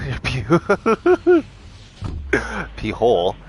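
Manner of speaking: breaking into high laughter mid-word; laughing. Real, not acted. Vlogger cracking up retelling the exam. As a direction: giggle through the silly word.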